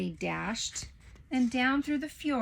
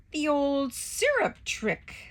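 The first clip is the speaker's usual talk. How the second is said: slow, smug, high drawl; smirking; 6 semitones higher, 4 dB louder, slower than usual. Acted. Waffle smugly gloating over the syrup trick. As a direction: savour it with a smirk. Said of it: "said Waffle with a smirk".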